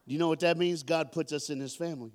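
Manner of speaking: ordinary talk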